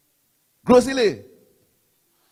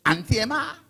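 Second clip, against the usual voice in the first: mock disgust, high rising question; scornful; 7 semitones higher than usual. Party speaker mimics the rival MP for the crowd, scornful. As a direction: imitate a disdainful, high-pitched query. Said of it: "Scornful and disgusting. That's how he is".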